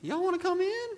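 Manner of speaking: put-on high, sing-song Southern accent; Southern hospitality voice. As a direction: chirp it like a sweet Southern host. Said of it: "everybody's like".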